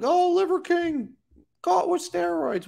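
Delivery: high, slow whiny mock outrage; sarcastic high-pitched fake outrage; mocking outraged fan. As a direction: squeal it like a shocked fan. Mocking people freaking out.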